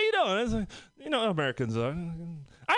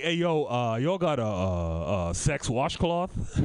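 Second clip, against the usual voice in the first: much lower, slow, shy mumble; sheepish deep voice; 10 semitones lower, slower than usual. Retold or imitated. At the shop, asking for a cheap one, low and slow. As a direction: drop way down and drag it out embarrassed.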